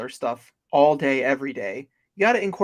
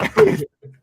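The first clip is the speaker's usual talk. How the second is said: cartoon squeal laugh; 9 dB louder than usual. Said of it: "horrible impersonation of the episode".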